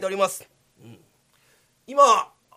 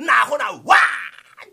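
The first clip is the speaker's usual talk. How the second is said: slow, louder mock whoop; retold cheer; 5 semitones higher, 10 dB louder, much slower than usual. Guard reenacting the laughter the samurai heard, mock whoop. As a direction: stretch the cheer out big. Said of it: "ちゅうた声が表へ".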